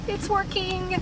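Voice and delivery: high, overjoyed squeal of relief; thrilled. Real, not acted. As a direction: squeal that the repair worked. The repair has just succeeded.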